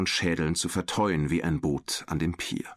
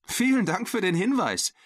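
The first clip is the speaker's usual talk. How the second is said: high, playful narrated retort; amused reply. A narrator's reading. Girl answering the creature's teasing, amused. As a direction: answer the tease lightly, pitched high. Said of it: "erwiderte Sarah lachend".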